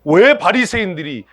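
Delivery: normal talking voice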